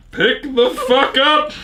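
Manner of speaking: high, slow announcer call; fighting game announcer. Shown as a demonstration. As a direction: boom it like an arena announcer. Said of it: "my announcer voice".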